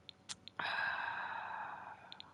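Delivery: real weary sigh; long heavy sigh. Real, not acted. Player stuck in the glitch, sighing heavily. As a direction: let out a long, voiceless sigh.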